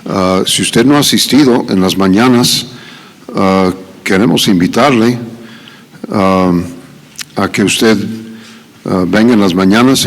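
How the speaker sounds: normal talking voice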